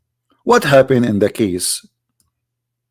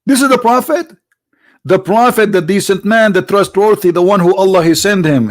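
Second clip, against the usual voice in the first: higher, outraged sarcasm; indignant disbelief; 6 semitones higher than usual. Real, not acted. Preacher mocking the prophet's titles, higher incredulous.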